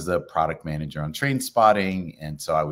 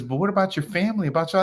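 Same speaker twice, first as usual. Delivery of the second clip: imitating worried friend; 10 semitones higher, much faster than usual.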